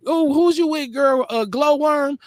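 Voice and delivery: high-pitched mock girlfriend voice; gossiping girlfriend. Host imitating women gossiping about last night, girlfriend voice. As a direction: pitch it up like nosy girl talk.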